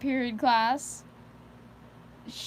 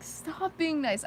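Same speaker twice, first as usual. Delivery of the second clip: higher, drawn-out mock complaint; high-range voice; 6 semitones higher, slower than usual. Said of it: "get into this high-range voice".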